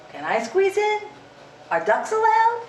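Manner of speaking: high quacky storybook duck; squeaky duck voice. Acted.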